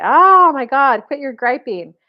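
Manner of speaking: loud, drawn-out husband's groan of protest; mock exasperated outburst. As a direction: act fed up, leaning on the first words. Husband finally gives in, exasperated.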